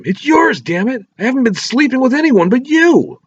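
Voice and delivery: high, loud desperate scream; screaming back. A narrator's reading. Wife screaming back at accusing husband, high and loud. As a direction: shriek it at him, pleading to be believed. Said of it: "She screamed back at him".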